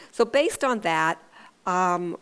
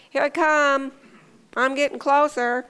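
higher, louder calling out from the couch; half-hearted seeker; 6 semitones higher, 6 dB louder than usual. Retold or imitated. Parent calling from the couch to hiding boy, half-hearted seeker. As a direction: call out a token warning without searching. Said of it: "imagine if I had just half-heartedly".

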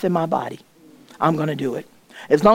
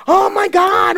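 amazed exclamation; 11 semitones higher, slower than usual. Retold or imitated. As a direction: gasp it out in disbelief. Doctor amazed at the scan.

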